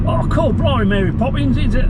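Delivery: high, hammed-up fake British accent; mock Mary Poppins British. Mocking American filmmakers, high put-on Mary Poppins British accent. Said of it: "don't just think".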